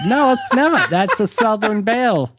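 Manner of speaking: high, indignant Southern belle; Southern belle accent. Pretend. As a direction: protest in a high Southern debutante voice. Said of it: "That is my Southern voice".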